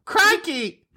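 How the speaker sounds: a burst of high, mock Australian "Crikey"; jokey Aussie exclamation in place of French; hammed-up Australian Crikey; Australian accent. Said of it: "That's not French".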